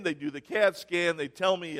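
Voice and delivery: ordinary talk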